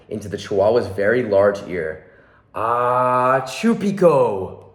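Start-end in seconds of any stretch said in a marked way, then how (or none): 2.5-4.6 half sneezed password. Acted. Skipito sneezes the secret password into Don Diego's ear, slow and sneezy. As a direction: stretch it into a slow sneeze, Chupico on the blast. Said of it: "half sneezed, half spoke the secret password".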